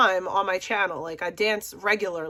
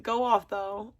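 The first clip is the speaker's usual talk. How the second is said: ordinary talk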